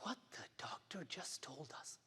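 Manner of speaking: hushed whisper. Acted. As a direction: breathe it like a secret. Speech, confiding the bad news.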